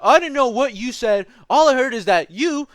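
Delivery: high, loud imitated drunk guy; mock drunk coworker. Drunk coworker accusing the narrator, high loud mock voice. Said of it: "he was like".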